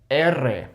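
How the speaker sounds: Spanish letter name "R" as EH-rreh, trilled; rolled double R. Shown as a demonstration. Naming the double R spelling, trilled "R". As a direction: roll "R" as erre. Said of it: "here is the double R spelling".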